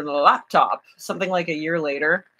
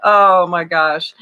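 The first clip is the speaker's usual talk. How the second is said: ordinary talk, nothing marked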